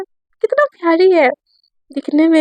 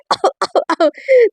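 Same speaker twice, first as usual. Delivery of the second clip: sudden acted cough, higher than usual; mock coughing fit; 4 semitones higher than usual. Satya suddenly starts coughing at Ayesha's teasing, acted cough. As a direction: splutter in a pretend choking cough. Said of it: "Satya ना एकदम से खांसने लगती है".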